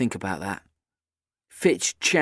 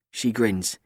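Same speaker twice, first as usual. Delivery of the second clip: ordinary delivery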